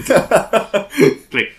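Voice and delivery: high, loud, laughing "click"; click sound effect. Acted. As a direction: say the hang-up click. GM voicing hang-up sound.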